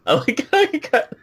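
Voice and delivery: high-pitched mimicking lilt; mimicked sing-song. Teasing co-host about his impression, mimicked sing-song. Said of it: "kinda got sing-song".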